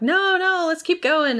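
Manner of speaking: high, whiny plea to keep going; a light, high-pitched mock protest; mock eager Jack. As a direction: beg to carry on like an overexcited kid. Jack begging to continue.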